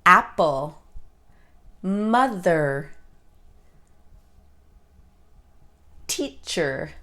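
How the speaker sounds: APple, MOther, TEAcher, each stressed on the first sound. Shown as a demonstration. Teacher giving example words Apple, mother, teacher, first-sound stress. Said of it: "the first sound is stressed".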